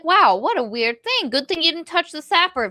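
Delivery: high-pitched feigned innocence; playing dumb. Powerful visitor covering for Vis, playing dumb. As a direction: act oblivious with an exaggerated wow. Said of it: "he's playing dumb".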